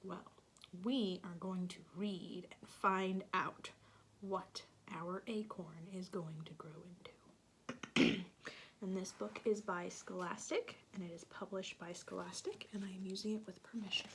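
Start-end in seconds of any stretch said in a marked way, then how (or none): 4.2-8.2 soft secretive whisper. Real, not acted. Teacher whispering a story teaser. 11.0-14.1 quick, hushed aside; fast whisper. Real, not acted. Reader whispering the credits quickly.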